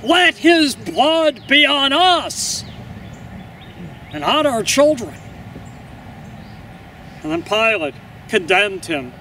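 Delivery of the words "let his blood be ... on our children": crowd crying out. A narrator's reading. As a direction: call it out word by word like a mob. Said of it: "the crowd cried out".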